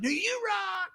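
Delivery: playful high-pitched insistence; gushing return compliment. Retold or imitated. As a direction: throw the praise back in a high squeal. He calls back to fans.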